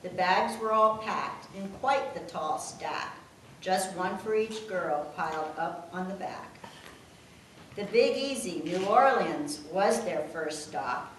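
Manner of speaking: reading rhyming verse aloud; steady sing-song poem read; poem recital. Acted. Daughter reads the family poem to her mother, recital voice.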